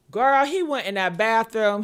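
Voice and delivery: ordinary delivery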